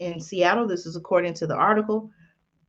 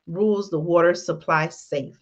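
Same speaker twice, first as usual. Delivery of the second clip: normal talking voice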